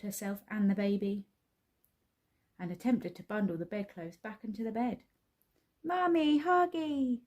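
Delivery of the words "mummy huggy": put-on much higher toddler cry; screaming toddler. A narrator's reading. Baby wanting her mother, high toddler scream. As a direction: wail like a small child. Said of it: "screamed the baby".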